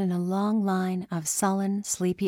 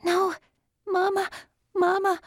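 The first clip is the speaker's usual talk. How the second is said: high desperate scream for mama; 12 semitones higher, 3 dB louder than usual. A narrator's reading. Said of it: "She screamed".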